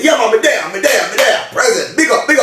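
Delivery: a hint of a Jamaican man answering; Jamaican accent. Donovan answering in a light Jamaican accent. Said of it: "Donovan is a Jamaican".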